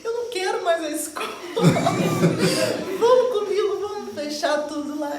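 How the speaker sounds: high-pitched begging, breaking into laughter; retold pleading. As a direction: plead hammed-up, half laughing. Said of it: "eu implorei". (súplica encenada, aguda, entre risos)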